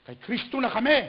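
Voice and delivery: high, loud mocking boast; mock the proud with a lofty voice; smug boast. (mayabang na pagyayabang)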